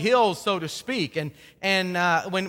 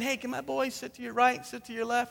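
mother's plea; 4 semitones higher, 6 dB quieter than usual. Retold or imitated. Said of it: "they put their mom Salome up to him".

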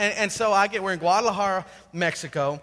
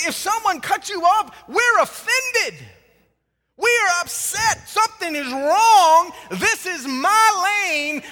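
higher, louder, slowing to drive each point home; fired-up preaching; 7 semitones higher, 5 dB louder, slower than usual. Acted. Pastor preaching to the congregation about road rage, higher and louder. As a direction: preach it with rising fire.

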